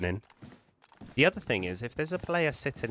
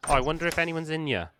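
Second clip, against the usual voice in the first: higher, quick, mildly put-on wondering; lightly mocked unwary player; 7 semitones higher, faster than usual. Gamer mimicking a careless player at a doorway, lightly mock curious. Acting out an incautious player entering.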